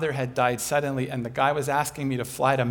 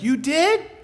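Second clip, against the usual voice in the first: much higher and louder, amazed; surprised question; 11 semitones higher, 7 dB louder than usual. Retold or imitated. Speaker hearing the neighbor's news, high surprise. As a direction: gasp it out in disbelief.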